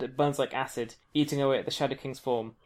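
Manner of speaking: normal talking voice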